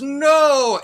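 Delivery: high mock scream of a doomed henchman; a hammed-up cry as he's thrown. Bad guy thrown to shark.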